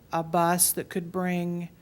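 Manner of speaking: usual speaking voice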